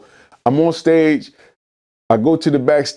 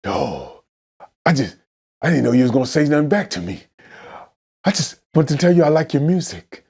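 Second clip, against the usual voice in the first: lightly voiced kid, lower and hesitant; imitated sheepish kid; 3 semitones lower than usual. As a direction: back down meekly, with halting pauses. Kid backing down when confronted.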